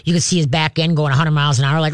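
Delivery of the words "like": normal talking voice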